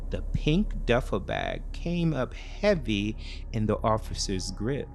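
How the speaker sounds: plain reading voice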